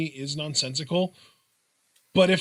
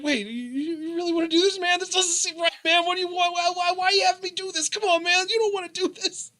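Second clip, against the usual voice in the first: much higher put-on voice; pleading referee; 13 semitones higher than usual. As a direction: imitate a reluctant ref pleading, stammering. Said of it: "even the ref was like".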